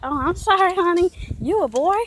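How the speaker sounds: high, laughing mock of the lady's apology; laughing customer. Retelling a customer who misgendered them, high and laughing. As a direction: raise it up and laugh through a patronising sorry. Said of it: "she laughed, like".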